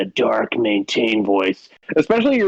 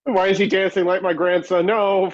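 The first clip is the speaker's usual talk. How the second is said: panicky, rising higher and higher; scared hiring manager; 6 semitones higher than usual. Pretend. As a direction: act totally freaked out. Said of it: "they're just like".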